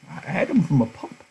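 put-on very high, strained old man's voice; cling to the dog with every word; pleading old Candy. Old Candy begging to keep his dog, very high.

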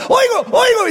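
yelling that he can hear; excited shout. Retold or imitated. Healed man shouting to the pastor in joy.

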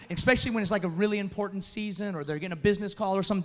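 ordinary talk, nothing marked

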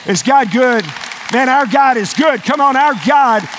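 loud, high preacher's call; full-force pulpit exhortation; rallying the congregation. Real, not acted. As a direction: rouse the crowd to respond.

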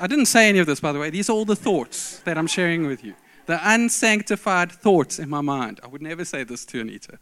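ordinary talk, nothing marked

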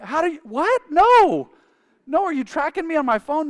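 high, loud caught-out yelp; mock startled panic. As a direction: act caught red-handed. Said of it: "I'm like".

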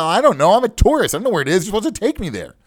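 high, flustered retold protest; flustered tourist. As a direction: imitate a helpless tourist protesting. Tourist answering screaming driver, acted.

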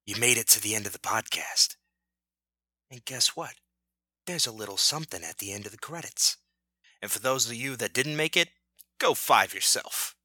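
low, quick confidential voice; conspiratorial aside. Pretend. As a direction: let listeners in on a secret. Post-credits secret aside.

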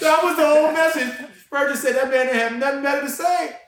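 rising into a full excited preach; fired up. Real, not acted. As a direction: tell it like you're lighting up the room. Excited preaching about simplicity.

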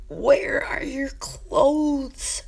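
a high, slow, breathless whispered cry; voiceless yelp of shock, slow and high; whispered shout. Acted. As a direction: scream it without making a sound. Silently screaming at naked guardian.

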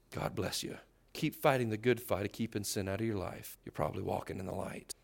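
hushed, near-whispered blessing; a quiet pastoral whisper of encouragement; soft blessing. Pretend. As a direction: bless them gently under your breath. Voicing his gentle blessing.

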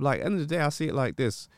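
normal talking voice

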